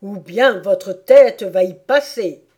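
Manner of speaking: loud, slow royal threat; angry King, loud and deliberate. Acted. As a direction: threaten him with full voice. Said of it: "avec colère".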